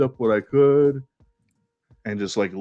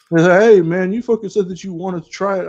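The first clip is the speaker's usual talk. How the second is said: mock protest; 8 semitones higher, 5 dB louder than usual. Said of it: "He was just like".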